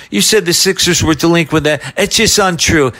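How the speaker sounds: a hint of an offended Croce; Pat Croce impression. Prank caller accusing the host, lightly voiced. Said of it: "And I start doing Croce".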